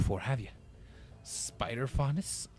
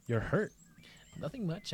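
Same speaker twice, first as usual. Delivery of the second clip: ordinary delivery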